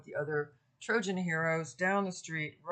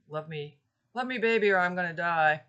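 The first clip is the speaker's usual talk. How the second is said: louder, hammed-up crooner pleading; mock rock and roll plea; 8 dB louder than usual. Lecturer mocking a sixties love song, hammed-up. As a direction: ham it up like a sixties rock singer. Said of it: "rock and roll notion of that".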